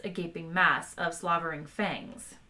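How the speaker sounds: usual speaking voice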